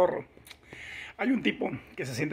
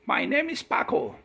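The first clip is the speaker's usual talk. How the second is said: mock English; 6 semitones higher, 3 dB louder, slower than usual. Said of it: "habla inglés".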